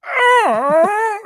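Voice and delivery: sudden high agonized yelp; pained yelp. Retold or imitated. Friend stabbed with a stick, a high pained yelp. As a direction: yelp like you just got stabbed. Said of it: "and he went".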